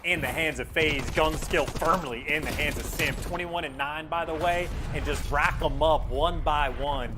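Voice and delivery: steady caster commentary; play-by-play. Real, not acted. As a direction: call the map as it happens.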